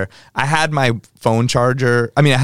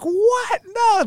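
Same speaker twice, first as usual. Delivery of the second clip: shocked protest. Retold or imitated. Girl being kicked out.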